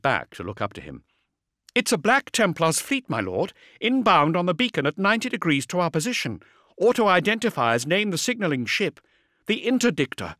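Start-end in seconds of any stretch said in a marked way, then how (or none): 1.7-10.3 brisk, higher female officer; vox officer voice. A narrator's reading. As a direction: report it like a busy comms officer.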